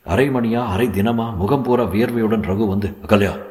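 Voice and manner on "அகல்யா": ordinary delivery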